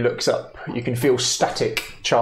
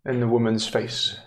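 hushed scene-setting with long pauses; measured, tender narration; game master narration; 4 dB quieter than usual. A narrator's reading. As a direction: let each phrase hang, storyteller style.

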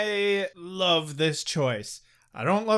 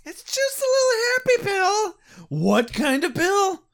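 squeaky high mock whine; high hammy voices; 10 semitones higher, slower than usual. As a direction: play it silly and high. Acted drugged exchange.

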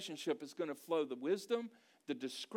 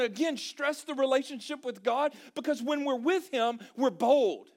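loud, raised-pitch sermon delivery; full-force preaching; 10 semitones higher, 9 dB louder than usual. Real, not acted. Preacher assuring congregation that God is present, full force. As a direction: drive each phrase home to the crowd.

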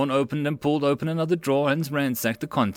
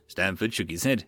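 ordinary delivery, nothing marked

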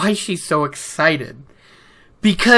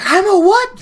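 high, loud disbelief; shocked squeak; 14 semitones higher, 4 dB louder than usual. Retold or imitated. Girl stunned by the news, high squeak. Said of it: "And she's like".

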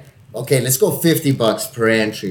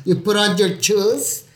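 slow, higher, hammed-up Mexican accent; 7 semitones higher, slower than usual. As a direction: drag out a Mexican "chooz" for shoes. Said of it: "I got so Mexican right there".

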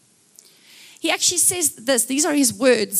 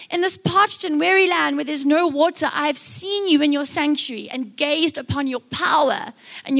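impassioned psalm reading; 4 semitones higher than usual. Acted. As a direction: recite a prayer with longing, drawing out phrase ends. Reads a psalm aloud.

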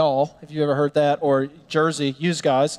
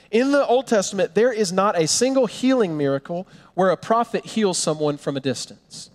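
ordinary talk

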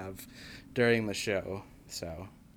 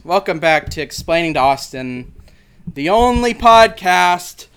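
announcer voice; 5 semitones higher, 10 dB louder than usual. Acted. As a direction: announce it like a show host. Show intro announcer read.

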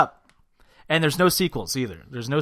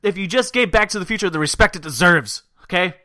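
loud heated outburst; loud rant; 8 semitones higher, 9 dB louder than usual. Real, not acted. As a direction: shout it, worked up. Fired up about being competitive.

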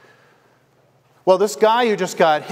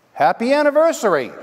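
higher, bright laugh through the words; laughing greeting; 8 semitones higher, faster than usual. Retold or imitated. Caller to friend on the crash anniversary, laughing. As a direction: laugh out the greeting like a happy surprise.

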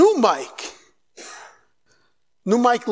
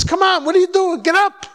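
challenging shout; 9 semitones higher, 4 dB louder than usual. Retold or imitated. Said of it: "Nobody says".

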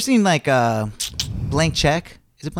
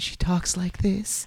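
soft, slow, higher imitation of a soothing voice; soothing coach voice; 5 semitones higher, 8 dB quieter, much slower than usual. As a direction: draw it out softly, mimicking an annoyingly soothing talker. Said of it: "her voice is, like, almost annoyingly soothing".

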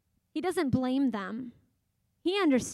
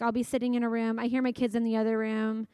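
normal talking voice

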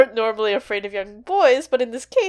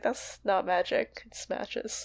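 quoted line, dropping very soft; slow, hushed deadpan correction; quiet aside; 10 dB quieter, slower than usual. Retold or imitated. Podcaster quoting the boy about his matches, quiet aside.

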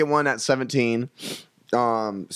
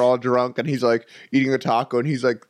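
usual speaking voice

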